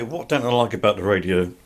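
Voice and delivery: normal talking voice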